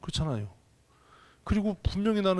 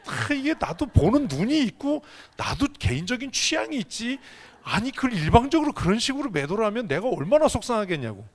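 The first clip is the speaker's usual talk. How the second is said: indignant outburst; 8 semitones higher, 3 dB louder than usual. Real, not acted. Preacher venting over church gossip.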